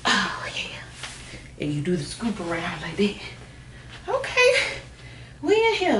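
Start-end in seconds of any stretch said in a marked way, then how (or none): 4.1-6.0 high, bright little cheer; triumphant squeal. Real, not acted. Vlogger to viewers once the tights are on, high triumphant squeal. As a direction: squeal it out, pleased with herself.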